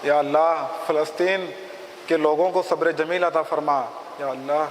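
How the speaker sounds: low, measured supplication; solemn prayer. Acted. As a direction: pray in short phrases, leaving pauses for response. Leads a prayer for Palestine.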